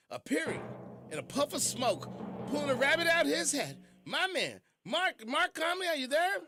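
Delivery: hype announcer. Real, not acted. Hype intro of magician guest.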